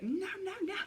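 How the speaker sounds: high, frantic no's in retelling; panicked protest. Retold or imitated. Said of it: "I started fucking freaking out".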